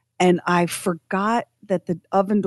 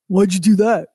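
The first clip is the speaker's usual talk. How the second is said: louder, blaming "Why'd you do that?"; ex's annoyed tone; 5 dB louder than usual. Retold or imitated. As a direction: snap it like an irritated ex. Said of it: "My ex would go".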